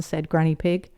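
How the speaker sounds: ordinary delivery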